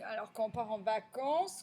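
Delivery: ordinary talk, nothing marked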